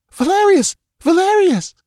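appelle Valerius, très haut et plus fort; un cri d'urgence dans le couloir; appel désespéré. A narrator's reading. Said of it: "calling his name in desperate urgency".

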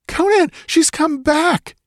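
very high, drawn-out woman's cry for help; slow, high-pitched scream of alarm; terrified shriek. A narrator's reading. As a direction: shriek the warning in terror. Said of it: "The shriek ended in a gurgle".

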